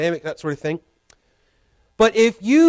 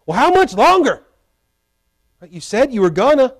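impatient plea; 6 semitones higher, 4 dB louder than usual. Retold or imitated. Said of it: "And David's going".

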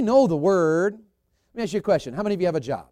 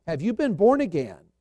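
ordinary talk

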